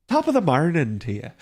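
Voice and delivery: corrected Irish accent. Shown as a demonstration. Streamer retries the Irish greeting for chat, mock brogue. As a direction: redo the greeting with a proper Irish lilt. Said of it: "it's not morning, it's marnin'".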